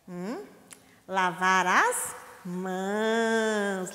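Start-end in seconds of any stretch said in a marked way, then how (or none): 1.1-3.9 drawn out, slow and clear; slow teaching read. Shown as a demonstration. Teacher reading the sign slowly to children. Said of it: "teacher models slow reading".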